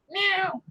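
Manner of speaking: high little meow to the cat; mimicked cat meow. Owner meowing to her old cat. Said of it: "I'm mimicking her meows".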